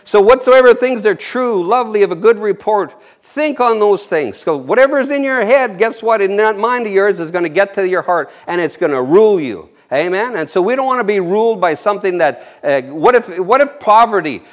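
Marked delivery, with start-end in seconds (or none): none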